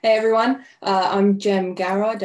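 normal talking voice

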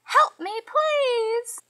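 high, loud plea; crying out for help. Acted. Trapped mouse calling for rescue, crying out high and loud. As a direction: cry out high, stretching the final word. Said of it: "The mouse cried out".

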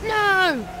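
sudden high shout of dismay. Real, not acted. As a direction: yell it out in shock. Ball goes in, shouts.

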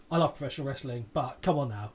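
ordinary talk

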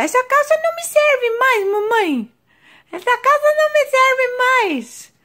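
put-on very high, squeaky little-baby voice; high pretend baby voice, slowing on the last line. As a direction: speak for the baby as a tiny squeaky toddler.

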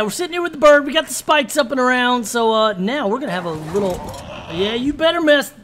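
normal talking voice

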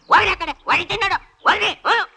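high-pitched shooing; scolding. Acted. Man shooing quarrelling children away with a threat, scolding. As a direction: chase them off with a threat.

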